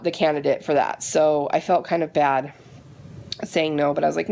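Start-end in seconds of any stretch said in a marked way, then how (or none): none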